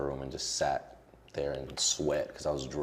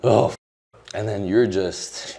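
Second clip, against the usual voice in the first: sudden loud crack as tears hit; real emotion breaking through; 5 semitones higher, 10 dB louder, slower than usual. Real, not acted. Guest breaking down while telling the interviewer about his mom.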